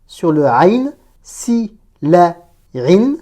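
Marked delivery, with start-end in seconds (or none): 1.2-3.2 slow, with a pause between each syllable; careful segmented demo. Shown as a demonstration. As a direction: break the word into its separate syllables. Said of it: "Il y a un tanwin ici avec la kasra".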